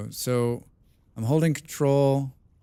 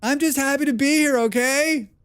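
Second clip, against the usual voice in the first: much higher, louder, gushing; excited voice; 12 semitones higher, 4 dB louder than usual. Real, not acted. Streamer playfully defending being loud to chat, high and excited. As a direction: squeal how glad you are to be there. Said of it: "That's my excited voice".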